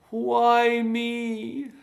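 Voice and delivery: high, whining "Why me?"; whiny voice. Shown as a demonstration. Coach imitates a parent whining at their lot. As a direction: whine it like a self-pitying victim. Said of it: "Usually in a whiny voice".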